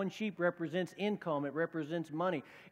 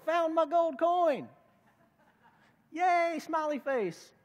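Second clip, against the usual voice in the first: much higher, louder, slow and gushing; gleeful post; 10 semitones higher, 5 dB louder, slower than usual. Retold or imitated. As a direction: read the Facebook post like a thrilled show-off. Woman posting found coin on Facebook.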